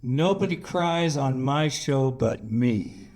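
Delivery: slow, drawn-out put-on voice of a self-important actor; slow mock-proclamation in someone else's voice; imitating a co-star. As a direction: draw it out slowly, mimicking a little tyrant. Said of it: "this voice from this little son of a bitch going".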